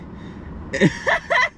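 airy wheezing laugh; breathless giggle; breathy high laugh. Real, not acted. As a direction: let out a silent wheeze. Laughs at friend's line.

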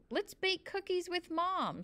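a little girl's mock shriek, held high; excited shriek. A narrator's reading. Annabelle runs off toward the house, shrieking high. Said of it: "she shrieked and began running".

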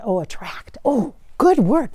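usual speaking voice

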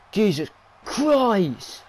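loud, drawn-out exclamation; high, stretched-out cry of shock; shocked shout. Real, not acted. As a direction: yell it slowly in disbelief at the move. Reacting to a brutal move.

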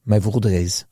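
low, put-on French accent storytelling; mock French accent. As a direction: tell it as a hammed-up Frenchman. Joking on in fake French.

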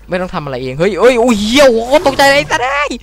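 loud, high jump-scare shouts; scared gamer cursing at the screen; startled yelling. Real, not acted. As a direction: jolt and curse at the scare.

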